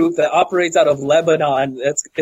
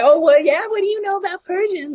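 much higher mocking imitation of her; mimicked snippy woman; 13 semitones higher than usual. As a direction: mimic her comeback in a high-pitched voice.

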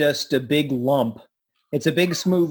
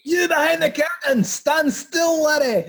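put-on Scottish accent; 11 semitones higher than usual. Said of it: "Go and do your Scottish accent".

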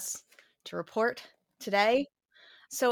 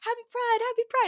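mock cheery; faster than usual. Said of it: "I see all of the posts".

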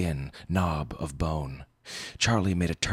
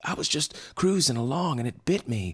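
high, fast, rattled retelling; shaken; 8 semitones higher, faster than usual. A narrator's reading. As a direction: rush through it, much higher, still in shock. Bitten surfer retells the attack.

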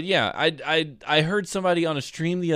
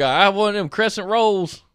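loud, slow, higher-pitched South Alabama drawl; South Alabama accent; 7 semitones higher, 4 dB louder, slower than usual. Acted. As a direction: order like a hammed-up South Alabamian. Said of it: "'cause you're from South Alabama".

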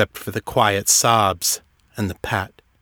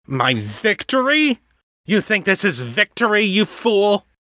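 contemptuous fury, pitched higher; angry scorn; 11 semitones higher than usual. Acted. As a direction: spit out the insult. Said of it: "an angry swipe".

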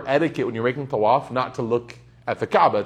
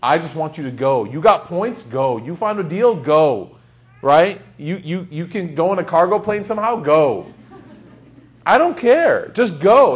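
rising, louder pleas to just go; insistent urging; 3 semitones higher, 6 dB louder than usual. Real, not acted. Preacher urging his audience to go, insistent and loud. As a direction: push them hard to go, building.

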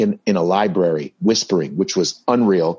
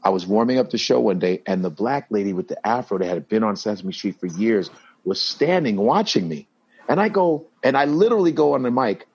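normal talking voice

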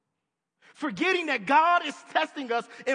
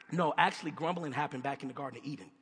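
ordinary talk

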